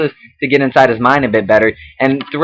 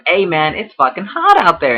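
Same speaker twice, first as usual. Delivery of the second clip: casual voiced quote; 5 semitones higher than usual. Shown as a demonstration. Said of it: "Michael's like".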